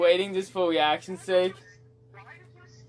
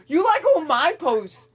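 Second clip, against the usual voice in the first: high, loud, indignant callout; indignant shout at a friend; heated accusation; 11 semitones higher, 5 dB louder than usual. Real, not acted. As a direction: call them out loudly, voice jumping up. Heated teasing accusation among friends.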